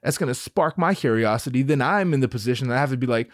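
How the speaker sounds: usual speaking voice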